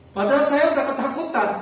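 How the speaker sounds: ordinary delivery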